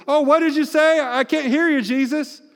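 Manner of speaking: playing deaf to Jesus, hammed-up; a hammed-up self who won't listen; mock distracted self. Mocking self ignoring Jesus.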